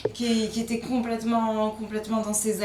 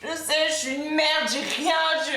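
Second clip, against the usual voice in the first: distressed, hearing voices; 6 semitones higher than usual. Acted.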